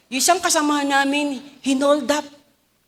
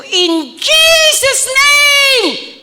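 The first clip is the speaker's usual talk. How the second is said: high, loud, very slow command; commanding shout; 13 semitones higher, 9 dB louder, much slower than usual. Retold or imitated. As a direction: drag out every word like a rebuke. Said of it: "sabi ko".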